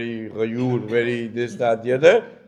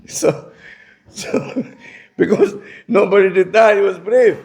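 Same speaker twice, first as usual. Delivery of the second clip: talking through laughter, higher and louder as it goes; laughing; 7 semitones higher, 5 dB louder than usual. Real, not acted. Teacher amused by his own story, laughing. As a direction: laugh your way through the explanation.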